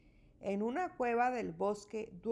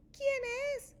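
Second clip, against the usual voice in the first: very high squeaky call; mouse calling out. A narrator's reading. Mouse calls to the shining eyes, high squeak. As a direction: pipe it up like a startled mouse. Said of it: "grita Ratón".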